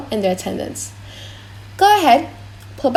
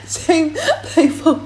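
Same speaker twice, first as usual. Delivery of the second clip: laughing; 6 semitones higher, slower than usual. Real, not acted. Tries to resume while laughing.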